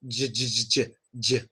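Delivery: low, repeated "j" as "juh"; isolated J sound. Shown as a demonstration. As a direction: drill the bare "j" consonant in bursts. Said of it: "It's your tongue vibrating".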